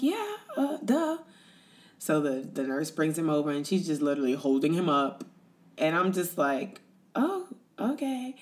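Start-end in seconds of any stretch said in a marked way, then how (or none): 0.0-1.2 very high-pitched mock duh; playful, much higher, as if it's obvious; retold "obviously" reply. Retold eager, obvious answer to question. 7.1-8.4 high, slow, hesitant acceptance; politely unsure, pitched way up; retold taken-aback reaction. Retold underwhelmed first look at baby.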